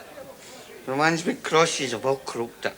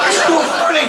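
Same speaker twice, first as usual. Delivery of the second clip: alarmed protest; 11 semitones higher, 7 dB louder, faster than usual. Acted. Panicking about the pink drink.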